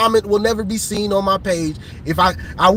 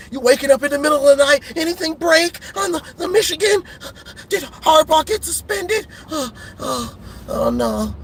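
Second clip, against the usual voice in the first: mock frantic fan; 6 semitones higher than usual. Imitating frantic fans checking news.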